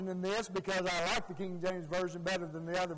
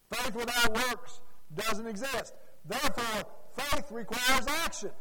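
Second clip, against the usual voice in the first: impassioned preaching; 3 semitones higher, 5 dB louder than usual. Acted. Preacher builds force again.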